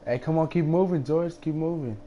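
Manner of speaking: ordinary talk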